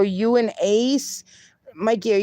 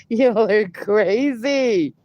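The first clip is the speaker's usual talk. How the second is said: ordinary delivery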